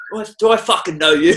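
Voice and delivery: high, loud suspicious snap; hostile stranger. Retold or imitated. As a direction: play the wary Englishman rebuffing a hello. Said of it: "I'm like".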